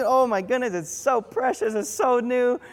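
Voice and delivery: put-on high-pitched cooing over a baby; mock gushing. Parent adoring a precious first child, mock gushing.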